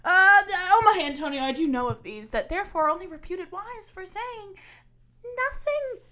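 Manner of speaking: loud, building stage declamation; declaim, building to the end; dramatic monologue. Shown as a demonstration. Actor performing the monologue for a friend, very loud. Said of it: "Here's how I would do this one".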